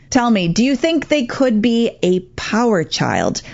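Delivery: ordinary talk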